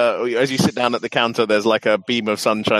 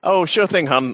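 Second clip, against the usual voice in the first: lightly voiced, higher-pitched waitress; a touch of chirpy diner server; 7 semitones higher than usual. Game master as waitress taking a tea order, lightly higher voice. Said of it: "I wave at a waitress".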